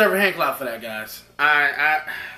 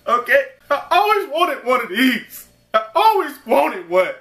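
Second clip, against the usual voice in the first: higher, hammed-up fake weepy gush; mock emotional; 6 semitones higher, 3 dB louder than usual. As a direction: ham up being overcome. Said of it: "No, I'm just playing".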